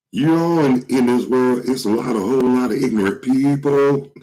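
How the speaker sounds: high crooning imitation. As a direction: croon it like a soul singer. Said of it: "say it like [name] just said".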